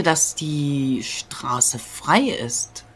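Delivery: frei ist stretched very slow with a falling tune; drawn-out doubt. Real, not acted. Player hesitating before telling Müsli the road is clear, very slow. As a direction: draw every word out, doubting.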